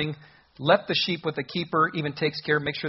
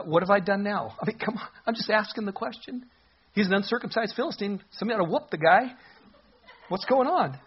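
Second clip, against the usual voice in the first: laughing protest; 5 semitones higher than usual. Retold or imitated. Preacher voicing David answering his brother.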